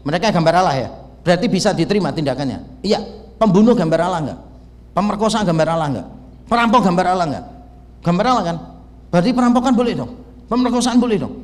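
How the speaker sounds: a sarcastic debater going for the kill; humiliating comeback. Retold or imitated. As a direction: hammer the professor with mock questions. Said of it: "saya itu mempermalukan dia".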